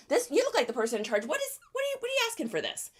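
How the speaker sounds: high, soft fawning flattery; butter up the bored seller; flattering the seller. Pretend. Buyer flattering the bored kid at the money table, high and soft. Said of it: "And they're like".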